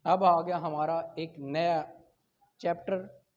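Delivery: normal talking voice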